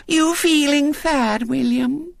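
put-on very high, slow lisping little girl; sugary small-girl sympathy; Violet Elizabeth, sweetly. A narrator's reading. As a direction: coo it sweetly in a piping child voice. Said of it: "she said sweetly".

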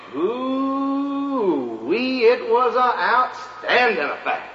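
drawn-out hoo-wee, rising high; folksy wonder. Acted. Storyteller telling delegates about the fair, wide-eyed and slow. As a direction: marvel at it like a country yarn-spinner.

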